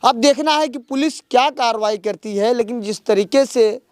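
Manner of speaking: earnest field-reporter summing up for camera; news report. Acted. Reporter wraps up the story for viewers, earnest news delivery. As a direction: report it like a TV correspondent on location.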